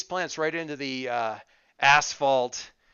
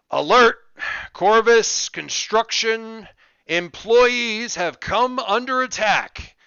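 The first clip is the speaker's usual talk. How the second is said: loud, slow announcement through a bullhorn; 7 semitones higher, 7 dB louder, slower than usual. Acted. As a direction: blare it like a bullhorn warning. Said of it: "bullhorn voice from out on the school property says".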